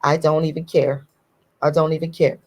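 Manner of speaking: ordinary talk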